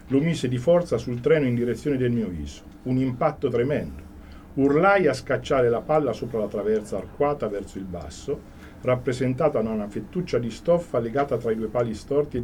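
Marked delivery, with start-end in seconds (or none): none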